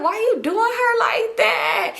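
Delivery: high, drawn-out wail of fake concern; mock pity. Scolding him over how he treats her, mock-pitying wail. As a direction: stretch it into a high, teasing whine.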